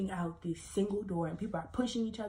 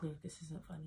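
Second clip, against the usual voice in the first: stern mom; 9 dB quieter than usual. Retold or imitated. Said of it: "And my mom was like".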